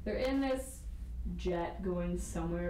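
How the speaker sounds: normal talking voice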